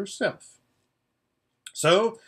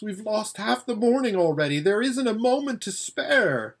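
urgent higher voice; 7 semitones higher than usual. Acted. Voices Erebus.

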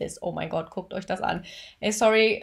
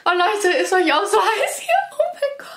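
high, climbing flustered voice; overwhelmed high-pitched fangirl fluster; flustered squealing; 10 semitones higher than usual. Real, not acted. Flustered and hot after the video.

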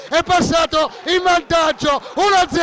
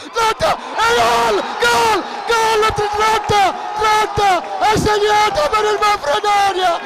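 breathless italian goal commentary; 7 semitones higher than usual. Real, not acted. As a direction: scream the goal call, then ride it down. Goal scored, commentator erupts.

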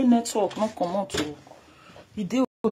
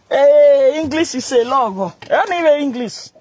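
loud joke; 4 semitones higher, 7 dB louder than usual. Real, not acted. Loud joking exclamation.

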